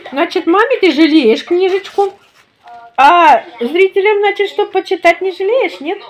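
high, loud mock reproach; playful teasing. Real, not acted. Teasing the toddler about the book.

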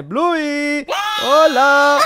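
high, loud call through the door. Acted. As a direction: shout ¡Bluey! to the house. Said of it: "no grites tanto".

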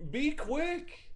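high-pitched, punchy exclamation; excited call-out. Real, not acted. Calling out the card's player excitedly.

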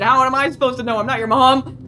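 high, loud mock exasperation; exasperated mock yell. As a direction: snap back loudly, playing annoyed. Mock quarrel with game character.

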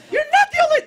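very high mock squeal; panicked high voice. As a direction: squeak out a confrontation, absurdly high. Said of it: "my voice raises, like, thirty octaves".

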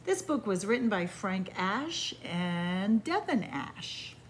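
ordinary delivery, nothing marked

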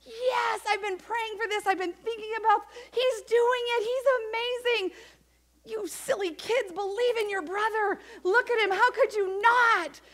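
high, delighted mother's voice; Mary amazed. Acted. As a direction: gush over her son proudly. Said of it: "she was like".

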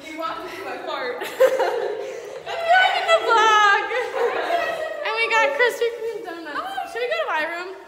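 bubbling laughter after dinner out; laughing. Real, not acted. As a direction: talk while cracking up with friends. Giggly night out with friends.

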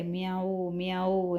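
normal talking voice

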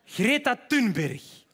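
normal talking voice

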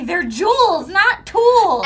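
mom's saying. Retold or imitated. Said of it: "my mom would always say".

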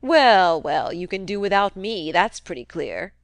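put-on a crusty old man needling his nephew, loud; gruff old uncle. Old uncle goading nephew Fred about the rumour, crusty taunt. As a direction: jab at him like a sly old miser.

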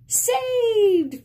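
high, loud cheer of triumph; a bright victorious shout; excited shout. Acted. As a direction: yell it out as the ball is saved. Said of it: "Franklin shouted".

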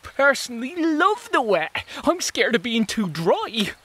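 high, cheerful bug voice; cheerful springtail. Acted. Springtail telling the others it loves the wet. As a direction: delight in the wet.

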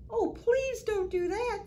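high, slow, pleading little voice; little fish voice. Acted. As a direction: beg like a tiny fish. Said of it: "said a little voice".

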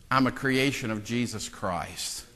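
usual speaking voice

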